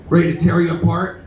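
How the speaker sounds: usual speaking voice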